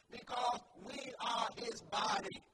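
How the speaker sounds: preacher driving the point home; forceful preaching. Acted. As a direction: proclaim it strongly to the congregation.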